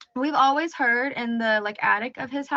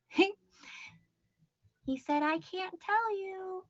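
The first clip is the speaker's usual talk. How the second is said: put-on creepy kid voice; 8 semitones higher, 9 dB quieter, slower than usual. Creepy child quote in ghost story.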